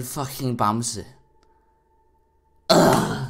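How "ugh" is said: loud, high groan of frustration; frustrated groan. Real, not acted. Gamer fed up after losing, loud groan. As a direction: let out a loud exasperated groan.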